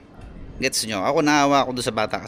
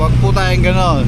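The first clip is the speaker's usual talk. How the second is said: louder, pleading; loud plea; 5 semitones higher, 9 dB louder than usual. Real, not acted. Rider pleading loudly with parents to stop yelling at kids. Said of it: "repeats plea loudly".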